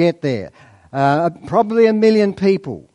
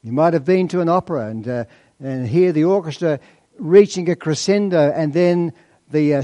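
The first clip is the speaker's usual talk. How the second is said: ordinary talk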